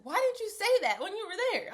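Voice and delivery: high, loud incredulous friend; puzzled roommate. Retold or imitated. Roommate questioning her after the store, high and incredulous. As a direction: roommate asking why she stayed silent.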